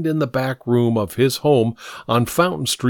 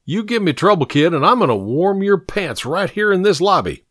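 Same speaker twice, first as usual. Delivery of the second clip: raised-pitch scolding threat; threatening; 7 semitones higher than usual. A narrator's reading. Hod warning Pink in the lobby, threatening. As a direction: warn the kid off, voice pitched up.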